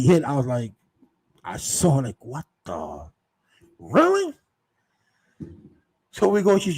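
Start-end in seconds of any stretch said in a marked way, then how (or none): 2.2-3.1 low, muted disbelief; stunned realization. Retold or imitated. Waking sober, retold shock. 3.8-4.3 high-pitched incredulous question; mock disbelief. Waking sober, retold disbelief.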